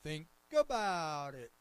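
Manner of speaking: loud, slow imitation with a long stretched ABOUT; put-on voice, swooping down through a drawn-out ABOUT; church lady's call-out. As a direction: mimic a congregant hollering from the pews. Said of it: "I heard her little voice".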